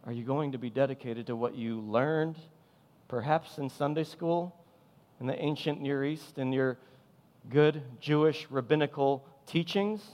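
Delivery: ordinary talk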